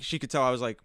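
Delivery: ordinary talk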